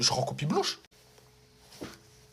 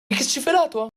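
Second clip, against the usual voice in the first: mock vice principal; 8 semitones higher than usual.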